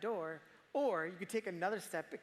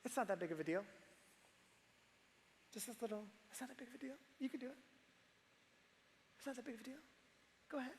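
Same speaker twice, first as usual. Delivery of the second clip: hushed, higher-pitched coaxing voice; soft and sly, as sin luring someone in; tempting whisper; 5 semitones higher, 8 dB quieter, much faster than usual. Acted. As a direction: murmur it like a small secret temptation. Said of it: "starts with a little whisper".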